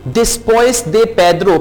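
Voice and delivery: Después de Pedro said slowly, word by word; slow, careful model for repeating; slow model phrase. Shown as a demonstration. Teacher slowly models Después de Pedro for repeating.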